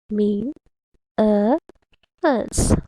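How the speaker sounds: MINERALS in three separate beats, "Mean, a, else"; syllable by syllable. Shown as a demonstration. Teacher counting syllables for kids, syllable by syllable. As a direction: pause after each part of "Mean, a, else". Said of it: "How many syllables?".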